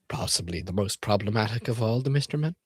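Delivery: ordinary talk, nothing marked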